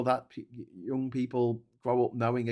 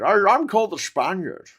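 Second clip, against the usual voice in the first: loud, higher Scottish impression with slurred s; hammed-up Scottish star claiming to be Spanish; Sean Connery; 9 semitones higher, 8 dB louder than usual. Scottish actor introduces himself as the Spaniard, mock-grand slurred accent. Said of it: "most outrageous one for Sean Connery".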